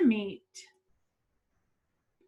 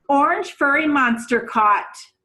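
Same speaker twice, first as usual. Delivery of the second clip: news flash; 4 semitones higher, 7 dB louder than usual. Acted.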